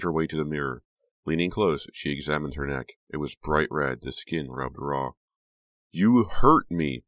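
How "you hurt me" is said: slow, loud accusing words; angry. Acted. Becca accuses Honey via the mirror, slow and angry. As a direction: spell out each word in anger. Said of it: "Becca said angrily".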